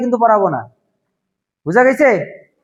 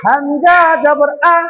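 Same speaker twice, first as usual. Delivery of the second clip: slow, sung-out "আ", drawn long like a chant; stretched vowel; 2 semitones higher, much slower than usual. Shown as a demonstration. Teacher demonstrates the wrong long drawn "আ" to the class. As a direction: hold the "আ" far too long, the way students wrongly stretch it. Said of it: "তাও টান হয়ে গেছে".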